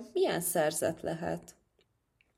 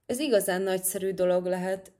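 usual speaking voice

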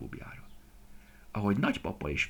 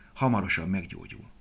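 usual speaking voice

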